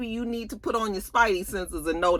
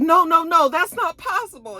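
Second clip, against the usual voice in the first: high, louder protest; mock outburst; 13 semitones higher, 4 dB louder than usual. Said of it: "He was like".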